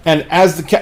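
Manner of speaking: usual speaking voice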